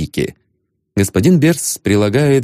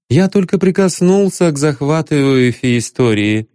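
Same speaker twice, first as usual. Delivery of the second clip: reproachful; 7 semitones higher than usual. A narrator's reading. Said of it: "укоризненно сказал он".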